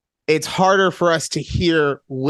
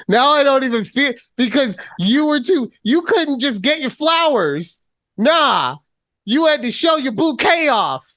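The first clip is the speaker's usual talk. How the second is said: playful scolding; 7 semitones higher than usual. Acted. Teasing scolding of co-host.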